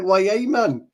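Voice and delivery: high, loud Geordie catchphrase; Geordie accent. Acted. Showing friends how Geordies talk, Geordie accent. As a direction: call it out like a Geordie, high-pitched. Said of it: "Geordie's like".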